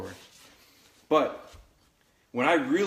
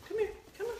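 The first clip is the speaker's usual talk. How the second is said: much higher, sing-song come here; high puppy call; 8 dB quieter, much faster than usual. Real, not acted. Trainer coaxing the puppy over, high sing-song call.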